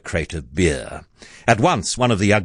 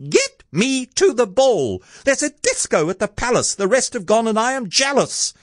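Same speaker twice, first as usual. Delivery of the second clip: very high, hammed-up tantrum voice; high-pitched demanding character voice; shouted demand. A narrator's reading. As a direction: reel off demands as a shrill, spoilt Cindy. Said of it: "beat her fist against the wall and shouted".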